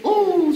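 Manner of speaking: long, high, loud "ooh"; sustained ooh at a near miss; excited ooh. Real, not acted. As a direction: react with a big high ooh. Reacts to a close guess.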